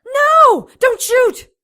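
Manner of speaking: very loud, high cry; desperate shout. Acted. Stopping her partner from firing at the vampire, desperate scream. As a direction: scream at him to hold fire.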